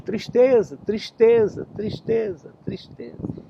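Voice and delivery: eco que vai virando sussurro; eco sumindo. Acted. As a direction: repita cada vez mais fraco, até sumir. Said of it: "Aí vinha a resposta". (a floresta devolve o grito como eco que some)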